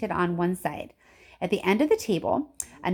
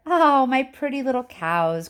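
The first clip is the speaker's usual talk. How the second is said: lightly raised, doting cry; cooing over his cows; fond little cry of delight; 5 semitones higher, 5 dB louder than usual. A narrator's reading. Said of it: "cried Mister Wonka".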